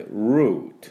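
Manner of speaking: ルート as "root", tongue never coming forward; stretched English R in ルート, crisp T; 綺麗なR. Shown as a demonstration. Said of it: "前に舌を出さないで、". (ルート を舌を前に出さずRのままで)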